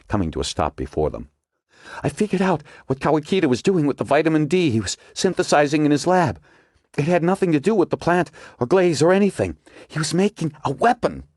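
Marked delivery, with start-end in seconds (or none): 2.0-11.2 higher, urgent woman character; Margot's voice. A narrator's reading. Margot explains her theory to the agents, urgent and higher. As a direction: voice Margot pressing her discovery.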